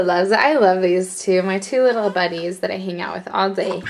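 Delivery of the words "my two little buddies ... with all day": ordinary delivery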